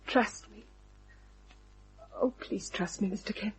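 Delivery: whispered appeal to Mister Kent; soft plea. Acted. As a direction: beg him gently to trust her. Pleading softly with detective.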